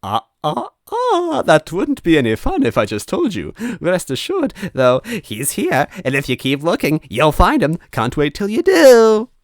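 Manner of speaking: high, loud, singsong villain teasing; teasing ghost Mario. Acted. Ghost Mario taunting the narrator, high and gleeful. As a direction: wag the words like a wagging finger.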